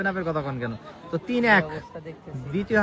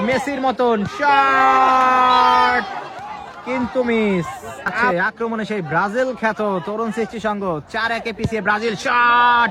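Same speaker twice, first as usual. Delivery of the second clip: frantic football commentary; 3 semitones higher, 6 dB louder, slower than usual. Real, not acted. Live play-by-play rising.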